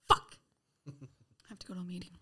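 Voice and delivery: whispered curse, then a breathy whisper; whispered dread. Retold or imitated. Dreading the meeting, whispering to herself. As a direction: hiss it under your breath. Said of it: "And I was like".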